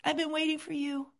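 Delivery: put-on high, soft small-child voice; little child's voice. Inner child answering the loving parent, small and soft. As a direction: speak as a shy little kid. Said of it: "hear a little voice say".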